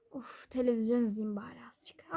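hushed whisper to herself; soft night-time whisper. Real, not acted. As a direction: whisper so the house stays quiet.

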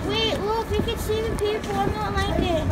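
quietly uneasy at the height; nervous hush. Real, not acted. Looking down from the elevator, quietly nervous.

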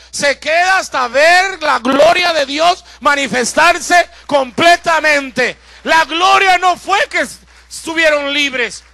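loud, slow fervent sermon; full-force preaching. Acted. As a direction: preach it home to the congregation. Predicación a plena voz. (predicación ferviente a todo pulmón)

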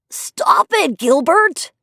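high, whiny plea with a rising end; whining. A narrator's reading. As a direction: whine it like a kid about to tattle. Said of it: "he whined".

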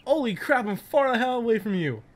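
ordinary delivery

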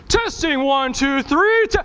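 high mic test, rising and cut short; sound guy mic check. Retold or imitated. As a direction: mimic a sound guy's loud mic test. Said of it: "what every good sound guy does".

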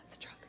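hushed gasp, then a breathy whisper; sharp gasp of delight, whispered; childlike gasp. Pretend. Pretend child gasps over a toy truck.

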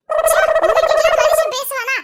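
loud, high fed-up exclamation; disgusted outburst. Acted. Wife fed up with husband's family, loud disgust. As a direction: explode in disgust.

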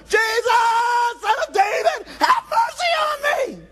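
very high, loud desperate shout. Acted. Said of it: "he cried all louder".

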